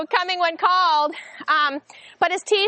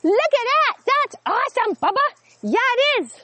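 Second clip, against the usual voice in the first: excited praise; 9 semitones higher than usual. Real, not acted. Praising puppy during tug game.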